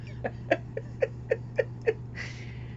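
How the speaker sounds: airy burst of laughter at herself; soft giggle bursting out; sudden laugh. Real, not acted. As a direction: break into a laugh at your own silliness. Laughs at talking to photo.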